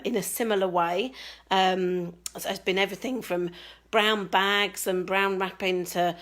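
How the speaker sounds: ordinary delivery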